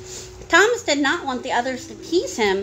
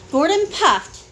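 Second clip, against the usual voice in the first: plain reading voice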